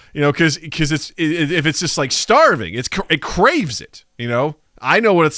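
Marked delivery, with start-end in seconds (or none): none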